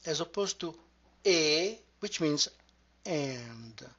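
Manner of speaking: ordinary talk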